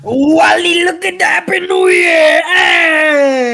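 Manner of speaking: high, loud New Year holler; whooping across the party; jubilant shout. Real, not acted. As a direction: yell it out in celebration.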